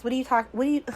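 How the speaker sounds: usual speaking voice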